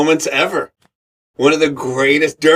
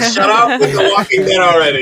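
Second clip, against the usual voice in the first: higher, louder exasperated snap; mock annoyed wife; 5 semitones higher, 4 dB louder than usual. Wife sick of his audition talk, mock exasperation. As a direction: imitate a fed-up wife. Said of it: "she was like".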